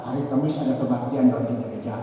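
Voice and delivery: ordinary talk, nothing marked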